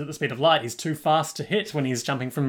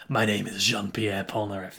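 Inigo Montoya declaration; 5 semitones lower than usual. Retold or imitated. Polnareff declaring his name to the villain, lightly done as Inigo Montoya. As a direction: announce your name like a duelist seeking revenge. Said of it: "It's very sort of Inigo Montoya".

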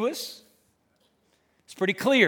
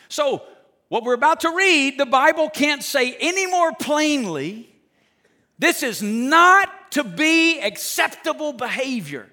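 raised, loud preacher's voice with hammered key words; full-force preaching; 5 semitones higher, 5 dB louder than usual. Real, not acted. Preacher telling followers what is never acceptable, forceful. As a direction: drive each emphatic word home to the congregation.

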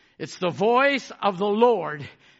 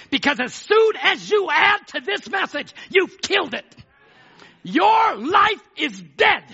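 raised, insistent pulpit delivery; full-force preaching; 6 semitones higher, 4 dB louder than usual. Real, not acted. Preacher warning church not to add to the message, forceful preaching. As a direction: press the warning on the congregation.

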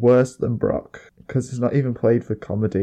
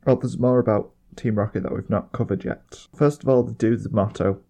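ordinary talk, nothing marked

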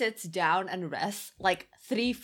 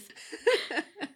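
high burst of laughter; 12 semitones higher, 3 dB louder than usual. Real, not acted. Podcaster cracking up at the ball organisers, laughing. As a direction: squeal of laughter, falling off.

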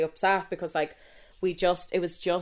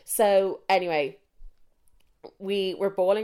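usual speaking voice

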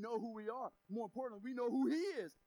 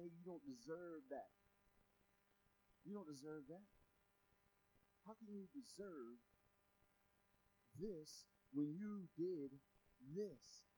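low, soft, accusing whisper of a tempter; Satan quietly accusing the believer, low and soft; 6 semitones lower, 9 dB quieter than usual. Acted. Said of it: "Like Satan might come and say".

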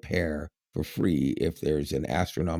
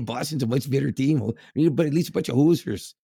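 higher, cheeky Canadian hockey heckle; Canadian accent; 5 semitones higher than usual. Retold or imitated. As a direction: chirp the Leafs in a Canadian accent. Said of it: "in a Canadian accent".

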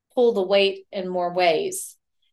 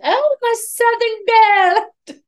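put-on Southern accent; 9 dB louder than usual. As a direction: imitate a husband's hammed-up Southern accent, pitched way up. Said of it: "in a really Southern accent".

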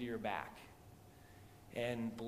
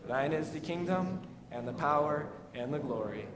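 louder, measured liturgical recitation; recited Lord's Prayer; 4 semitones higher, 9 dB louder than usual. Acted. Pastor leading the congregation in the Lord's Prayer, liturgical recitation. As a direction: recite the prayer steadily with the church.

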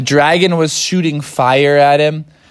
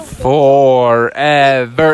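slow, drawn-out, eerie; spooky echo; 3 dB louder, much slower than usual. Acted. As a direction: stretch it out like an echo. Echoing ominous word.

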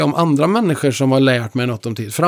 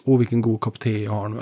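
soft, lower, savoring a small joy; savoring aside; 4 semitones lower, 6 dB quieter than usual. Retold or imitated. A man savoring tea despite hard times, softly. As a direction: softly relish the cup of tea. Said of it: "jag kan alltid glädja mig åt".

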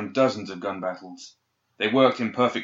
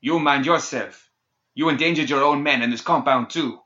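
snarling; 5 semitones higher, 5 dB louder than usual. A narrator's reading. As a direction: snarl it back at her. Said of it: "He snarled at the leader".

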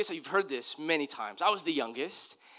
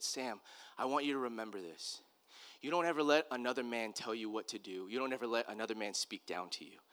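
low, hushed voice of a father instructing his son; solemn fatherly advice; 6 semitones lower, 8 dB quieter than usual. Retold or imitated. Dad to young son in the truck, quiet and solemn. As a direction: retell it softly and low, as grave counsel.

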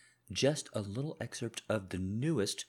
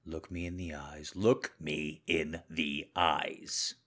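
controlled menacing husband; 3 semitones lower than usual. Acted. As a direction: speak low and controlled, as if you already know. Husband confronts wife, audio drama.

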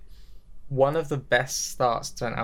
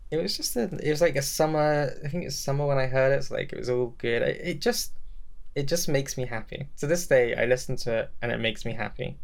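ordinary delivery

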